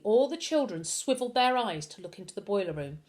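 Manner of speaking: usual speaking voice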